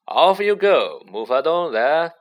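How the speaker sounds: loud, carrying dismissal; calling out. A narrator's reading. Lockhart to the crowd, calling out loudly. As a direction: call out to move a crowd along. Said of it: "Lockhart called to the crowd".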